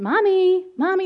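high, loud calling of Mommy through a door; a little boy calling out for his mother; child calling. Retold or imitated. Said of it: "I hear my son's, like, knocking on".

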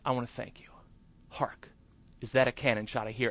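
soft, almost whispered line; hushed thanks. Retold or imitated. Actor thanking his agent backstage, hushed. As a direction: confide it quietly to her. Grateful private words to agent.

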